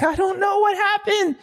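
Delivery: high, puzzled mock voice; a hammed-up confused rider; mock bewildered Ferrandis. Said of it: "He's like".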